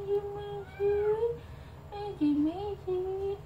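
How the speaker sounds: high singsong calling; high-pitched pet call. Real, not acted. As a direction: coax a pet over in a high, light voice.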